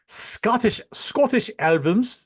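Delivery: put-on terrible Scottish accent. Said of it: "Terrible Scottish accent".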